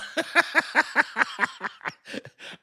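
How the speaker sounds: high airless laugh; wheezing laugh. Real, not acted. Silent laugh.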